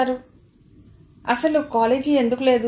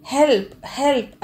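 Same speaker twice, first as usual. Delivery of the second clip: shouting for help; 3 semitones higher than usual. A narrator's reading. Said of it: "అని గట్టిగా అరిచింది".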